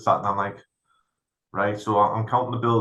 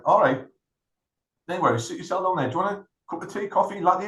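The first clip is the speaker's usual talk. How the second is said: much higher, breezy hostess voice; friendly receptionist; 8 semitones higher than usual. Retold or imitated. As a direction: play the overly helpful front desk. Receptionist offering drinks, retold.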